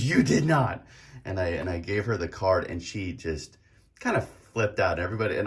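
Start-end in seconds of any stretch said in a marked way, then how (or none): 0.0-0.8 loud, high astonished exclamation; raised-voice mock shock; retold excited disbelief. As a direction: act out a friend flipping out in amazement. Friend flips out, retold.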